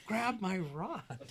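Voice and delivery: high, slow, drawn-out yell of "Grab my rod."; urgent yell. Retold or imitated. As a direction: stretch "Grab my rod." out loud and high in alarm. Repeated desperate call, stuck.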